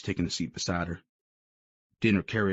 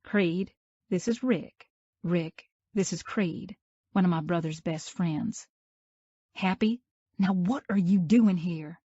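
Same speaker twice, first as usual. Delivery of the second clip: put-on much higher, slow young woman's voice; Mia, stiff introduction; 11 semitones higher, slower than usual. A narrator's reading. Mia introducing Creed to her date, high and impatient. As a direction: introduce them curtly, then demand.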